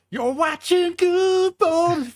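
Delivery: very high, slow warped voice; glitchy robot mic voice. Retold or imitated. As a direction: mimic a broken mic playing back.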